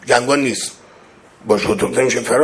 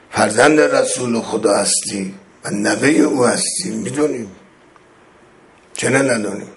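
ordinary delivery

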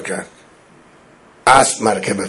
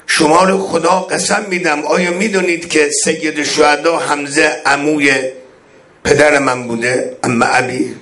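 beseech them by God to recognize you; imploring imam; 5 semitones higher than usual. Acted. Voices Imam's oath-pleas.

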